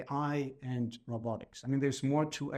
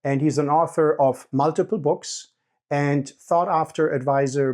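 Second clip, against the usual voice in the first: loud, measured presenter read; scripted podcast introduction; 12 dB louder than usual. Real, not acted. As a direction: read the guest bio aloud to podcast listeners.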